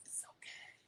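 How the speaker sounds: barely audible, voiceless whisper; whispered reply. Retold or imitated. Stranger reassures the mother without revealing his voice, whispering. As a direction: whisper it so your voice gives nothing away.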